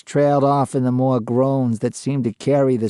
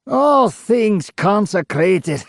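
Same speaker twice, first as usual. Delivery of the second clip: higher and slow, scornful; sarcastic; 7 semitones higher, slower than usual. A narrator's reading. Ghost mocking the interviewer's church question, sarcastic. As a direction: sneer at the word.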